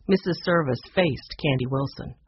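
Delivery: usual speaking voice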